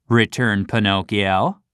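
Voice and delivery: plain reading voice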